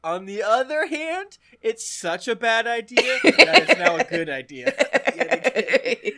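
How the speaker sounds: talking through laughter; giggling loud and high; laughing. Real, not acted.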